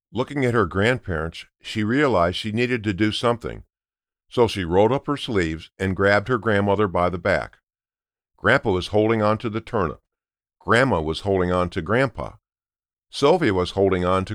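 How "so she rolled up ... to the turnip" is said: plain reading voice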